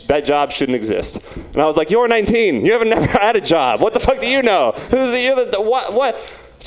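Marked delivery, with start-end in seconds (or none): 1.9-6.2 higher, sputtering disbelief; acted exasperated comeback; mock indignation. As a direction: splutter as if insulted by a kid. Acted outburst at nineteen-year-old.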